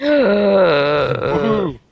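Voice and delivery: high whooped cheer. Real, not acted. Player cheering as the show wraps up, excited whoop. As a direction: let out a high, drawn-out whoop.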